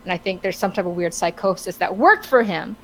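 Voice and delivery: ordinary talk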